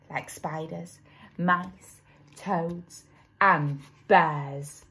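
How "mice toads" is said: ordinary delivery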